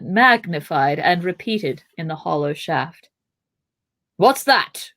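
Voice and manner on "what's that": sharp, higher startled question; cried out. A narrator's reading. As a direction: cry it out in alarm. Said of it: "cried Gandalf".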